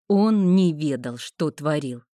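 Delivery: ordinary delivery, nothing marked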